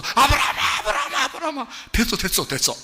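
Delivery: high, loud frantic calling; trembling, panicked God. Acted. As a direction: shout urgently to stop him, words tumbling out. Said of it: "하나님이 막 떨어서".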